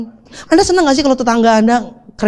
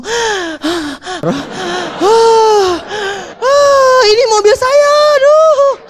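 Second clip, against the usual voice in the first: high, louder tearful cry of joy; overjoyed sobbing; 11 semitones higher, 3 dB louder, much slower than usual. Acted. Father handed new car keys by his child, sobbing with joy. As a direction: sob it out, overwhelmed with gratitude.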